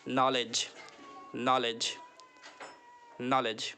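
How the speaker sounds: "knowledge" said slowly as "nolledge"; silent k. Shown as a demonstration. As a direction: drill "knowledge" with no K sound. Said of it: "के जो है silent हो जाता है".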